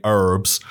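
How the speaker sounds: herbs drawn out slowly as "erbs"; imitated silent H. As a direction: mimic the American herbs, H dropped. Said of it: "what you would say".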